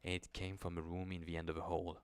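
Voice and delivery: low, soft storytelling voice; hushed horror narration. A narrator's reading. Narrator setting a creeping horror scene, hushed. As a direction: draw the listener into the dark.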